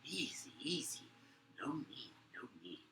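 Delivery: slow soothing guard voice, fading away; calming guard. Acted. Guard calming his angry comrade, softly fading. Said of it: "The other voice goes".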